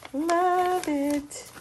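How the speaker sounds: high, long-held coo; sing-song delight, stretched out; adoring coo. Real, not acted. Crafter admiring a decorated pocket, cooing adoringly.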